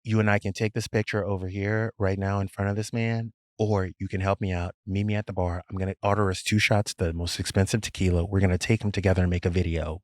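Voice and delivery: low, hushed deal-making; hushed pitch. Retold or imitated. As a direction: lean in and keep it quiet. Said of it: "I'm like".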